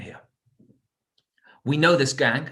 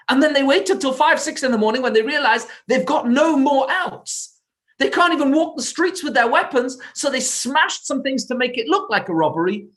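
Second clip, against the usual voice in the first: much higher and louder, pressing every point; urgent explanation; 11 semitones higher, 5 dB louder than usual. Acted. Policeman explains the ambush to the owner, urgent and loud. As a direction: make him grasp how close he came.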